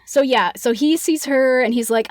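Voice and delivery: ordinary delivery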